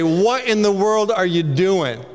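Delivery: scornful big brother. Retold or imitated. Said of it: "older brother like Eliab say".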